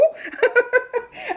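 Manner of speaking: a high burst of real laughter. Real, not acted. Cracking up at her husband's offer, high laughter. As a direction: let a high giggle burst out.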